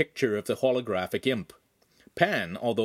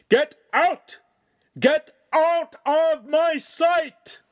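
very high, very loud, drawn-out scream; furious bellow; 8 dB louder, much slower than usual. A narrator's reading. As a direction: bellow at full force, stretching each word. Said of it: "bellowed in a voice so loud".

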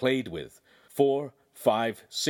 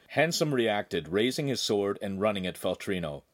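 ordinary delivery, nothing marked

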